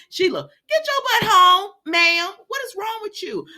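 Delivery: high, loud exasperated telling-off; playful high-pitched outburst; mock scolding. Scolding a soap character.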